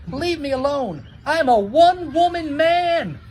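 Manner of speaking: high, loud, rising protest; shouting. Acted. Romeo yelling high and loud at the girls chasing him. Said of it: "Romeo kept shouting to the girls".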